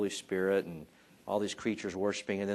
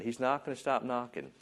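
usual speaking voice

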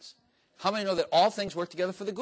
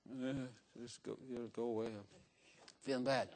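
sick groan; 5 semitones lower, 13 dB quieter than usual. Retold or imitated. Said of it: "retold sick self".

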